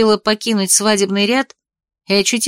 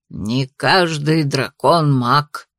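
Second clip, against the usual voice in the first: put-on low, slow old man lecturing; a doddering grandfather teaching; 6 semitones lower, much slower than usual. A narrator's reading. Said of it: "поучал внука дряхлый".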